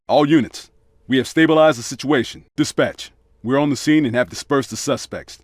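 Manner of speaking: low, even radio dispatch read; calm officer reporting over the radio; police radio chatter. Acted.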